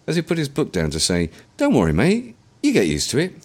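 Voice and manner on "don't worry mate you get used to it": higher and louder, easy reassuring mate; cheerful reassurance; friendly Londoner. A narrator's reading. Stranger on the train consoling William, friendly.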